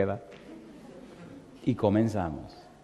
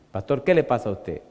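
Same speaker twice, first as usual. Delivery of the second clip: ordinary talk, nothing marked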